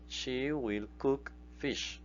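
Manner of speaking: very slow, word-by-word English; slow model sentence. Shown as a demonstration. Teacher modelling the future tense slowly for students. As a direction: lay out each word for learners. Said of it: "Ella cocinará pescado".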